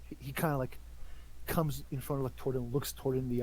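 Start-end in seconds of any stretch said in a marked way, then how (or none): none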